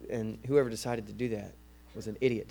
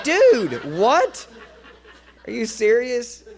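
much higher, louder mock disbelief; incredulous outburst; 9 semitones higher, 10 dB louder, slower than usual. Reacting to a church lady's veiled insult, incredulous. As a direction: blurt it out loud, acting stunned.

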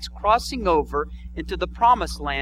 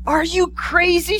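put-on girl voice; 10 semitones higher, 5 dB louder than usual. Girl voiced in told story.

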